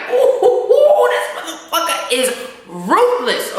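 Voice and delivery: high, squealing amazed "ooh" with a laugh; shocked reaction. Retold or imitated. Reacting to Ghost's cold line, high amazed squeal. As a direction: gasp at how cold he is. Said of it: "I was like".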